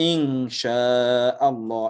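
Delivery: ইনশাআল্লাহ drawn out very slowly, syllable by syllable; slow Quranic recitation. Shown as a demonstration. Teacher recites ইনশাআল্লাহ slowly for the class. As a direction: stretch ইনশাআল্লাহ out with recitation rules. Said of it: "আপনি তখন পড়েন".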